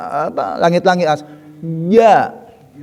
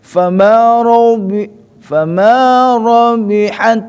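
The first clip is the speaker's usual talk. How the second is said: bacaan tilawah; 4 semitones higher, 4 dB louder, much slower than usual. Shown as a demonstration.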